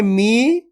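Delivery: मी with a long, held ee; मी as one sustained syllable; drawn-out long vowel. Shown as a demonstration. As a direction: stretch the vowel in मी. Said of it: "तो खींच के पढ़ेंगे".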